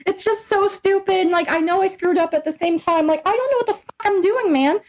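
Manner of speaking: high, mock-weepy retelling; tearful. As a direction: whine it as if holding back tears. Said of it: "I'm just tearing up".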